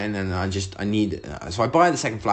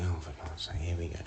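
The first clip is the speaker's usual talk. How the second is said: low, very soft resigned aside; imitated exasperated partner; 7 semitones lower, 13 dB quieter, faster than usual. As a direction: sigh it under your breath, here-we-go-again. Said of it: "Poor Leanne is looking at me like".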